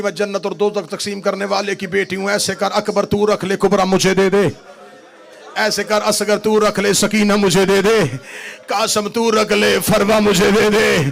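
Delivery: loud preaching building to a sung, shouted lament; plead, then wail it out; rising fervent lament, loud and chanted; impassioned plea. Acted.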